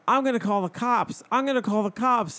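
hammed-up man threatening cops; mock threat. Retelling the man threatening the lady, mock loud. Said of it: "he was like".

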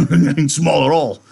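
loud, high jokey big-man voice; Carlson. A narrator's reading. Big man repeating his joke about the name, loud and jocular. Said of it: "He repeated".

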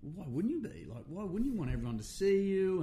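high, loud, disbelieving why would you not; pitching way up in amazed disbelief; incredulous ego. Retold or imitated. To the dying kid, mock disbelief, high and loud. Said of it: "and I was going".

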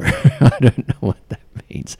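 real laugh breaking into the words; laughing bafflement. Real, not acted. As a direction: laugh as you admit you're baffled. Laughing at her own bafflement.

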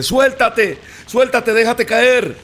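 high, drawn-out yell to let go; urgent father shouting. A narrator's reading. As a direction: shout up urgently to your son. Father urging son to let go. (grito apremiante de padre)

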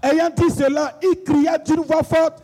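high, declaimed scripture verse. Shown as a demonstration. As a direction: proclaim it high for the congregation to answer. Leads congregation in raised call.

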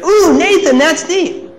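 high, loud gushing "Ooh"; gushing praise. Retold or imitated. Relatives cooing over the five-year-old, high gushing praise. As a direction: coo over the kid like proud relatives. Family praising the little brother.

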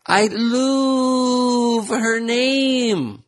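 very high, slow gushing squeal; gushing Khloe. Retold or imitated. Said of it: "Khloe tweeted".

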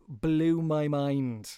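Blew my mind stretched out very slowly; slow emphatic repeat. Real, not acted. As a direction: drag out Blew my mind, leaning on Blew.

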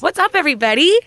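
high, bubbly greeting. Real, not acted. Host greeting podcast listeners after the intro, bubbly. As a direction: greet the listeners with bouncy energy. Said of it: "[name]'s bubbly today".

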